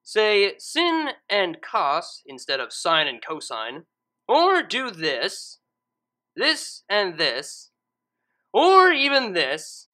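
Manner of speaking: slow, deliberate listing of errors; annoyed reader listing math sins one by one; slow pointed listing. Real, not acted. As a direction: spell out each mistake slowly. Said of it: "For people who do their multiplication".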